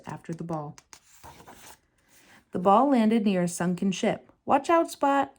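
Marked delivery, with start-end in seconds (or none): 4.4-5.3 shouted warning. A narrator's reading. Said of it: "Ariel shouted".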